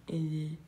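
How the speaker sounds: quiet, hampered اینجوری showing the tongue position; tongue-placement demo. Shown as a demonstration. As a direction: murmur اینجوری low with the tongue pinned down. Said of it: "نوک زبون تو ببر پشتش".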